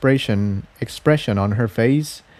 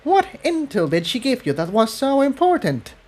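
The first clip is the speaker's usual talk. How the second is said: high, sharp challenge; shot back; 13 semitones higher than usual. Acted. Tiptoe snapping back at Cerulean, suspicious. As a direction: fire the question back at her. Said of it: "Tiptoe shot back".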